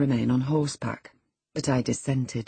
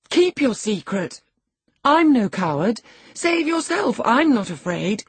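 raised voice, proud dismissal; scornful compassion; 7 semitones higher, 5 dB louder than usual. A narrator's reading. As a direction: declare your courage with pitying scorn. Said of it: "said Catherine with scornful compassion".

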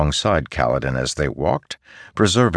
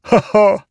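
high, loud gleeful cackle; sword chuckle; 6 dB louder than usual. A narrator's reading. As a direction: chuckle like a delighted living sword. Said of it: "listening to the sword chuckle".

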